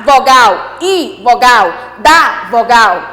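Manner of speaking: ordinary talk, nothing marked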